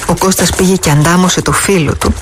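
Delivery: ordinary delivery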